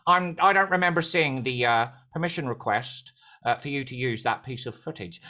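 low, hesitant put-on voice of a permissions boss; Gary. Permissions boss questioning the speaker about footage, low hesitant impersonation. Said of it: "he came up and he said".

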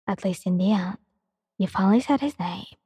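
calm, even reading of a romance story; story narration. A narrator's reading. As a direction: read the story aloud like an audiobook. Reads a fan story aloud.

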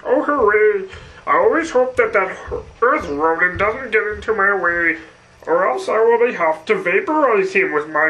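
put-on cartoon schemer voice; fussy little world-destroyer impression; cartoon villain. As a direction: gloat over your doomsday device in a cartoon voice.

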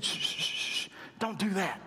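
whispered shushing; hushing. Retold or imitated. Entourage hushing blind Bartimaeus, whispered. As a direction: hiss them quiet.

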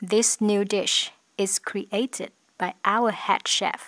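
slow, careful example sentence; slow example read. Shown as a demonstration. Teacher reads an example slowly for students. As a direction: spell it out by ear for learners. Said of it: "teacher reads example".

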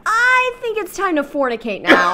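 high, silly put-on voice; laughing mock proposition. Reader spoofing the lover's proposition, laughing. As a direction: announce it grandly through laughter.

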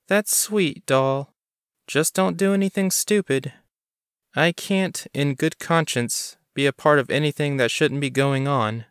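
sweet on top, a warning underneath; Bonnie warning. A narrator's reading.

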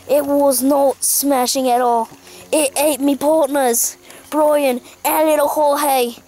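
slow, grave mock Aussie report; mournful Australian survival host. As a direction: recount the loss with hammed-up solemnity. Australian host recounts the bear attack.

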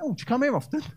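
high, cheeky chat-up line; camp flirty voice. Retold or imitated. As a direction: flirt playfully.

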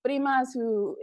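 normal talking voice

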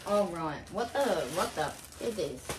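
usual speaking voice

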